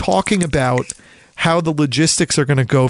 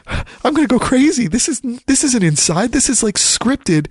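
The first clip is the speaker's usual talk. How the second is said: higher, half-joking cry of disbelief at the scripted show; mock exasperation; 8 semitones higher than usual. Podcaster mocking a staged Duke series, in higher, exasperated disbelief. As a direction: throw up your hands, voice pitched up in mock despair.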